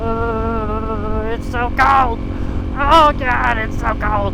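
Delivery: loud cold complaint; shouting. Real, not acted. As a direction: yell it out against the cold wind. Freezing ride, yelling about cold.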